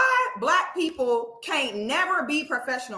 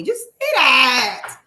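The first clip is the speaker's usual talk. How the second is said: loud, stretched-out plea; drawn-out, fed-up holler; exasperated demand; 5 dB louder, much slower than usual. Real, not acted. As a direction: belt it out slowly in exasperation.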